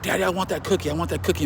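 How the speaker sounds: lightly mocked five-year-old's pleading; imitating small son. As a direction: mimic a little boy begging for a cookie. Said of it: "I got, like, a little son".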